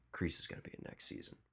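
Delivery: hushed secret whisper of the reveal. Retold or imitated. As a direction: whisper it like a spoiler.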